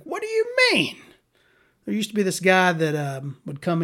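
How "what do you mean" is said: mock high squeak.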